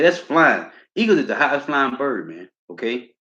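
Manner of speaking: normal talking voice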